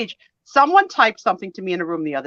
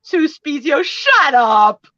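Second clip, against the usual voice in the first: higher, louder teasing "Sue Spezio, shut up"; playful shut up; 8 semitones higher, 7 dB louder, much slower than usual. Shown as a demonstration. Daughter showing the joking way to say "shut up", teasing. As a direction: tease "shut up" like a friend's joke. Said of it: "They probably said".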